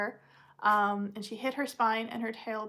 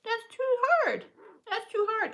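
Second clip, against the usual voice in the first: high scold to puppy; 10 semitones higher than usual. Real, not acted.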